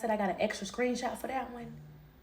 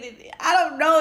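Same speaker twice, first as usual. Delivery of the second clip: ordinary talk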